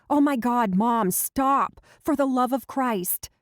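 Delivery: loud, high, exasperated protest; mortified cry. Acted. Laura cutting off her mom's birth-control talk, loud and mortified. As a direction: beg mom to stop, mortified.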